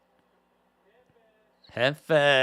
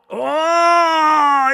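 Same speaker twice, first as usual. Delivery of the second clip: a sudden, much higher and louder cry of shock; excited shout; 10 semitones higher, 7 dB louder than usual. Real, not acted. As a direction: yell it out as the moment lands. Huge reversal in the ring.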